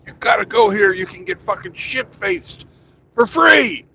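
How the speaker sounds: loud, high hyped-up pitch; navy buddy hyping the free-drinks bar, loud; excited. Retold or imitated. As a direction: sell it like a gleeful buddy. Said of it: "It's like".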